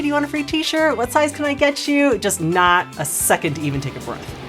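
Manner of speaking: ordinary talk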